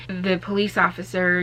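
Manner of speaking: ordinary delivery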